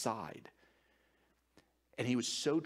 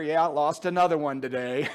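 laughing; 3 semitones higher, 9 dB louder than usual. Real, not acted. Joking about losing to Baptists.

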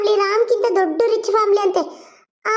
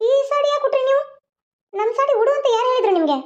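higher, accusing question at the mother-in-law; indignant demand; 5 semitones higher than usual. Acted. Daughter-in-law confronting her mother-in-law, indignant and raised. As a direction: demand to know why she wore it.

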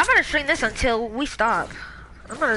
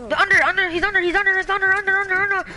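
urgent callout; 7 semitones higher, 4 dB louder, much faster than usual. Real, not acted.

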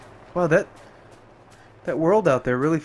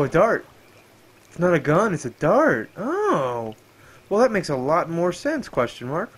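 ordinary delivery

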